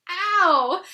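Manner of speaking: loud high yelp; pained cry. Acted. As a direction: cry out in pain. Pooh being pulled.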